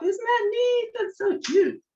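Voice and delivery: high, bright squeal of delight over the cute cap. Real, not acted. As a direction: gush with excitement at how cute it is. Delighted at finished bead cap.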